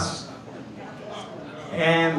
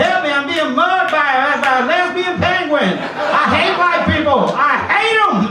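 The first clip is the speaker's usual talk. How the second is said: loud, high, shouted foul-mouthed puppet; Eddie Murphy-style dummy; 9 semitones higher, 5 dB louder than usual. Retold or imitated. Doll curses the boy in front of class, high and shouting. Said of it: "Lester started going".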